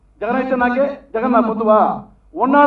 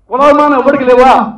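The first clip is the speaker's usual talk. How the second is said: "కులాభిమానం" pushed louder, falling at the end; second, louder demand to the reporter; louder repeat; 2 semitones higher, 7 dB louder than usual. Real, not acted. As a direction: hammer the question home louder. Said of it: "repeat of question".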